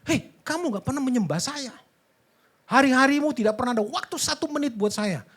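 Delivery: high, loud accusing reproach; mock anger. God imagined scolding neglectful believer, mock angry. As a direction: scold them as if wronged. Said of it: "marah dia".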